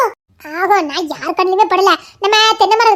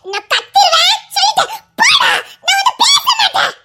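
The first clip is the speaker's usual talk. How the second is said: angry shouting; 12 semitones higher than usual. Acted. Daughter refuses angry father.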